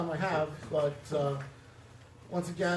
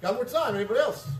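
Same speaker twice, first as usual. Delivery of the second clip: calling out; 6 semitones higher, 6 dB louder than usual. Real, not acted. Calling on the audience for questions.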